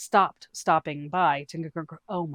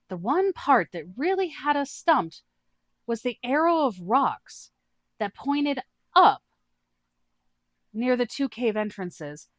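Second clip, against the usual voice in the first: higher, slow, thoughtful voice; a rival fishing for answers; Ao's character voice; 7 semitones higher, 4 dB louder, slower than usual. Acted. Ao questions the adventurers, higher and slow.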